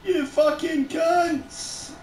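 slow, high, stretched-out swearing; long slow high wail of a curse; drawn-out curse. Real, not acted. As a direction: drag every word out in disgust.

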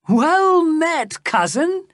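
very high, hearty greeting; delighted cry. A narrator's reading. The laughing king greets his lookalike cousin, delighted cry. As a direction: hail them loudly, high on the first word. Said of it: "he cried".

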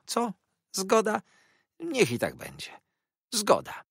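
high, wheedling conciliatory voice; coaxing schemer. Acted. Jurajach offers to settle the misunderstanding, coaxing. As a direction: coax toward a truce, pitch leaping up on the questions.

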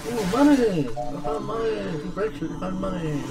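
giddy over the loot; excited. Real, not acted. Excited about finding money.

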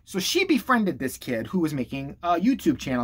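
ordinary talk

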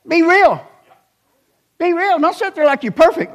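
high, loud preacher's challenge; preaching at full force. Acted. Preacher challenging the congregation to be real. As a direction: call the crowd out, fired up.

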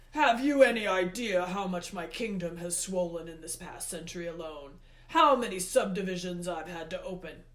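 loud, forceful god's rebuke; Hades, exasperated. A narrator's reading. Hades complains of too many subjects, exasperated. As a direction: rant at full voice, affronted.

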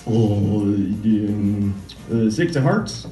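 ordinary talk

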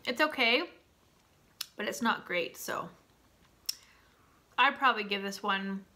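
chewing between words; eating while talking; mouth partly full. Real, not acted. Seen 2: Holds bar, lips pressed chewing between spoken phrases.

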